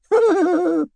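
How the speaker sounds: put-on high girlish giggle from an old lady. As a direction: giggle like a naughty young girl. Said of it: "She giggled girlishly".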